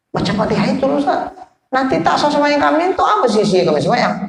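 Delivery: high-pitched worried plea, retold; startled congregant. Congregant asks ustaz about prayer validity, retold alarm. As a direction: act out an alarmed student appealing to the teacher. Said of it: "terkejut orang".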